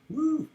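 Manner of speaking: short high-pitched whoop; high "woo". Real, not acted. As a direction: let out a quick, high "woo". Exclaims at the temperature reading.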